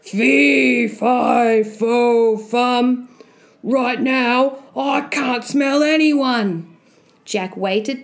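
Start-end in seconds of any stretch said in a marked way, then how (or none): 0.0-3.1 loud giant, pitched up at the start; loud muttering. Acted. Giant muttering above tense Jack, loud chant. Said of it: "muttering loud". 3.6-6.7 slow, raised giant's grumble; loud muttering. Acted. Giant sniffing in vain, loud slow grumble. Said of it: "muttering loud".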